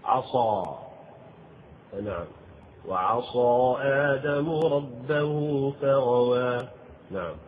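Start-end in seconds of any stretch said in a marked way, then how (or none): 2.9-6.7 slow tajwid recitation; slow Quranic chant. Acted. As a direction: recite the verse slowly with tajwid. Quotes Quran verse.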